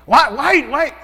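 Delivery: father angrily berating son Zeke; angry yelling father. Retold or imitated. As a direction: shout at your son in frustration. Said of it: "That's not love".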